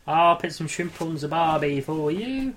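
slow, hammed-up Australian accent; a bad Aussie impression drawled out; mock Australian accent. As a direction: lay on a cartoon Australian twang, slowly. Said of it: "do an impression of an Australian person".

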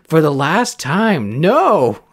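exasperated cartoon-dad shout, drawn out; slow, loud refusal rising to a high "no"; Homer Simpson. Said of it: "where Homer just says".

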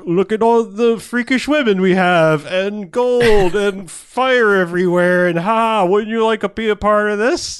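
loud, slow, grandly seductive offer; tempting villain voice. Retold or imitated. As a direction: lure them in like a decadent tyrant showing off his palace. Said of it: "where it's just like".

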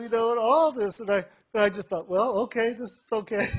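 high, loud, on the edge of laughter; amused. Real, not acted. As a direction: tell it through bubbling laughter. Amused recall after the outburst.

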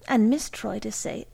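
ordinary delivery, nothing marked

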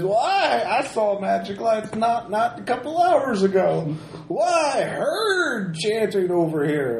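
hammed-up grizzled prospector; gruff mountain man. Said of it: "The old prospector in an English pub".

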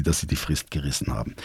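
low, fast, dry aside under the breath; sarcastic mutter. Real, not acted. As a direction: mutter it dryly, almost to yourself. Sarcastic comment on late reply. (trocken gemurmelter Seitenhieb)

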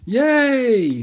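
high squeal of joy; excited. Real, not acted. News of Wreck-It Ralph two.

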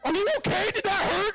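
high, laughing mock-alarmed doctor. Doctor surprised by arm movement.

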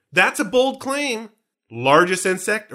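slow, drawn-out doubtful tone; skeptical. Real, not acted. Host doubts the largest insect claim, slow and skeptical.

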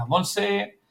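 normal talking voice

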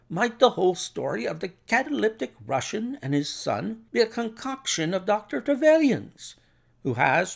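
high-pitched, tentative questioning; Watson suggesting. Acted.

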